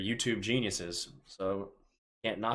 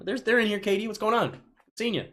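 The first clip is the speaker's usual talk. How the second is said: excited greeting; 11 semitones higher, 5 dB louder than usual. Real, not acted. Greeting regulars in chat.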